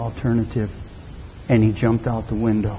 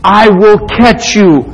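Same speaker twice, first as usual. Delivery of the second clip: slow, raised, emphatic call; insistent urging; 7 semitones higher, 6 dB louder, slower than usual. Acted. Daddy urging his trapped son to jump, insistent call. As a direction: stress each word slowly and firmly.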